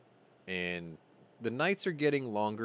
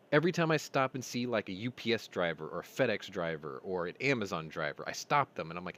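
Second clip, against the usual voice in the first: normal talking voice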